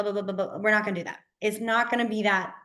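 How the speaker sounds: normal talking voice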